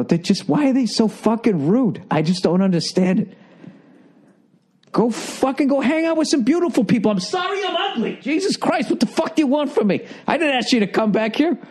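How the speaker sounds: ranting high and exasperated; retold rant. Telling Nia off about rude women, fed-up rant. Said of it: "I go".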